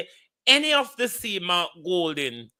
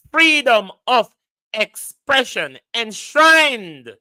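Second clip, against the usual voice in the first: emphatic rant; 6 semitones higher, 9 dB louder, slower than usual. Real, not acted. Ranting about right to cuss.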